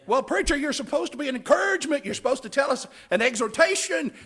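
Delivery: high, fast, loud whiny objector; mocking objector voice.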